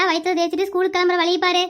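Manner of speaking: ordinary talk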